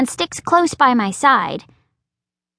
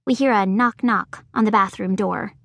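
ordinary delivery

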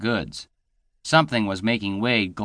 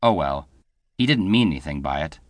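ordinary delivery

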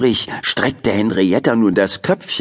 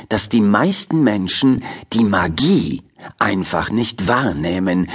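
usual speaking voice

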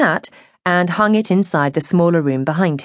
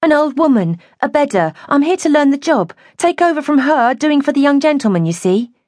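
higher, flustered tumble of words; rushed words; 9 semitones higher than usual. A narrator's reading. Joyce explains her new job to the man, rushing her words. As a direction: blurt out the explanation in a hurry. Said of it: "Joyce rushed her words".

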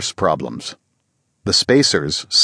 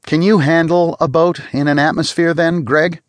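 higher, pointed challenge; challenging tone; 8 semitones higher than usual. A narrator's reading. Spacer challenges the rich passenger, pointed and higher. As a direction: test him with the question. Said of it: "In a tone of challenge".

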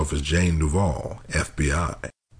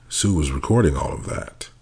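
ordinary delivery

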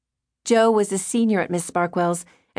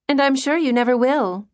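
archly; 6 semitones higher than usual. A narrator's reading. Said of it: "Trudy said archly".

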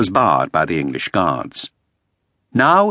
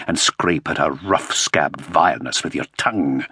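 low, soft and venomous; sneering interrogator; 4 semitones lower, 3 dB quieter than usual. Acted. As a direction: relish each filthy insult quietly. Topcliffe's low vicious gossip.

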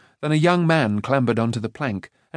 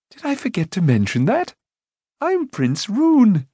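Prince Rune; 9 semitones higher, 3 dB louder than usual. A narrator's reading. Rune introducing himself to Taran. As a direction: proudly reveal the name.

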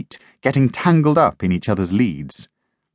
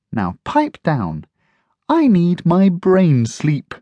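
drowsy know-it-all dog; 8 semitones higher, slower than usual. Acted. Einstein dozy voice.

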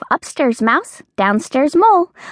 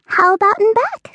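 squeaky mouse voice; 11 semitones higher than usual. Acted. Mouse character voice.

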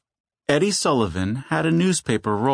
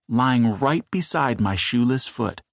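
plain reading voice

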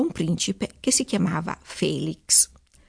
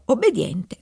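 ordinary delivery

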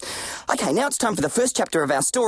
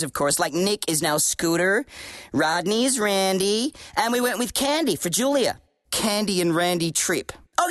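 put-on American accent. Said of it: "stop speaking like that?".

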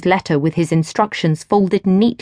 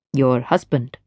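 lower, easy man's voice; smooth stranger; 7 semitones lower, 3 dB quieter than usual. A narrator's reading. Stranger chats to Gwen at the rail, low and easy. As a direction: speak low and relaxed, at ease.

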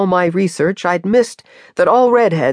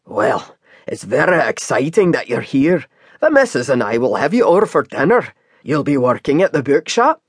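Scottish cab driver. Acted. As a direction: chat to the newcomer like an easygoing Scottish driver. Scottish driver voiced by reader.

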